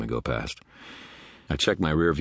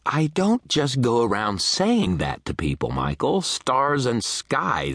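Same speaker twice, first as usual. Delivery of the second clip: high, slow, emphatic protest; cornered and flustered, voice pitched right up; exasperated outburst; 10 semitones higher, 4 dB louder, slower than usual. A narrator's reading. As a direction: burst out defensively, stretching the words. Cornered man protests in argument.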